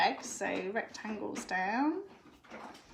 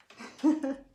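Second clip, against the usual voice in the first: giggle; 5 semitones higher, 8 dB louder than usual. Real, not acted. Reacting to her child.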